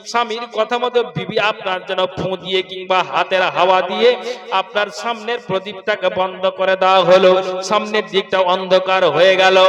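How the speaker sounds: loud, sing-song sermon chant; preaching. Acted.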